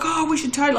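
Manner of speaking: high-pitched voice of your own brain; eager brain. Acted. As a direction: jump in with a new idea. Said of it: "my brain will say".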